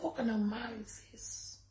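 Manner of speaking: hushed, breathy disbelief; whisper. Retold or imitated. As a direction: whisper it as if stunned by him. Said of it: "I said".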